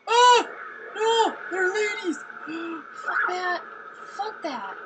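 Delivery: panicked screaming. Real, not acted. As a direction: yell in real panic. Two enemies appear suddenly.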